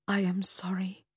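soft, whispery apology; gentle. A narrator's reading. Investigator consoling the raging bereaved mother, gently. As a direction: offer sympathy very softly. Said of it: "I said gently".

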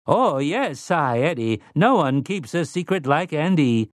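light mechanical butler tone; robot voice. A narrator's reading. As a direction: read it like a polite, smug machine. Robot Andy speaks.

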